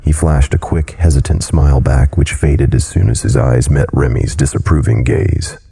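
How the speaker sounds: plain reading voice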